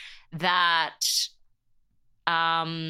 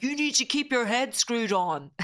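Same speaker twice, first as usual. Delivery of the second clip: higher, firm mock-stern father; dad's voice; 6 semitones higher than usual. Daughter quoting her father's warning in a mock-stern dad voice. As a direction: lay down the law like a strict dad. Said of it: "I'm with the voice".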